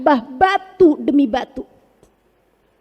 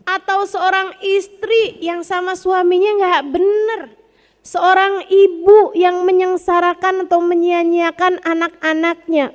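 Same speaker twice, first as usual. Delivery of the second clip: ordinary talk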